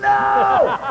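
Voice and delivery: long, very high wails; retold scream. Preacher imitating the next man on the ground, screaming. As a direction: shriek two drawn-out noes in mock panic. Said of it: "he was screaming".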